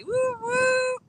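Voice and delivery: high whooping cheer. Real, not acted. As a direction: cheer him on. Cheering for moderator.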